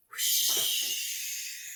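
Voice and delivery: breathy whoosh of wind. Acted. Reading to a child, a soft whoosh of wind. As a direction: blow it out like a gust.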